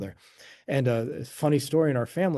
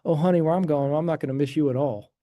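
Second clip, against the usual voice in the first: louder, put-on Appalachian hillbilly accent; playful Appalachian hillbilly grandma impression; 4 dB louder than usual. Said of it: "she had this hillbilly accent".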